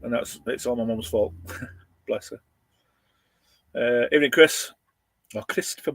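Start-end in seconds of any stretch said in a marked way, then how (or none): none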